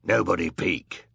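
put-on villainous man's command; villain's order. A narrator's reading. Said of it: "ordered the man with a beard".

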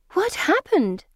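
high-pitched shocked question; gasping. A narrator's reading. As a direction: ask it in startled alarm. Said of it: "Elizabeth gasps".

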